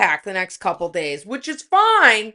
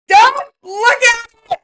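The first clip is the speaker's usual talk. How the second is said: high, loud, crying wail; mock anguished plea; 13 semitones higher, 7 dB louder than usual. Begging her husband not to look, loud mock crying. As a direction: wail it like it hurts to be seen.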